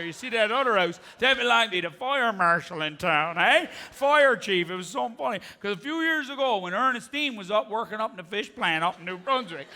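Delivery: put-on rambling local with a Newfoundland accent; chatty Newfoundlander. Newfoundland local gives a stranger endless pharmacy directions, rambling. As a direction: play a folksy, teasing Newfoundland storyteller. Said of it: "In Newfoundland, when you ask a question".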